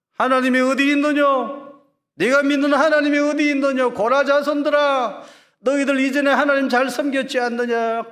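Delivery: high, loud jeering voice; mocking scoffers. Scoffers mocking the sons of Korah, loud jeering. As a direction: sneer at the faithful from a crowd. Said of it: "사람들이 비웃고 조롱하는 거예요".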